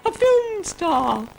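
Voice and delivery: very high, loud cow voice; Ermentrude the cow. Acted. Ermentrude the cow asking for praise, very high and loud. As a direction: squeal with pride like a cow. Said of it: "said Ermentrude, appearing".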